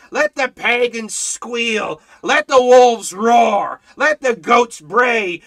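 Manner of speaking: slow, loud preacher's proclamation; fiery preaching. Acted. Preacher rousing his flock, slow and loud. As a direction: thunder it from the pulpit, slow.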